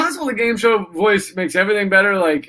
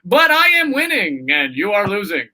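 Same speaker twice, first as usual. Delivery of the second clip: game show voice guy; 3 semitones higher than usual. Acted. Game show guy caught with contestant's wife, game show voice. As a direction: imitate a chirpy announcer delivering awful news. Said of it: "if the game show voice guy was like".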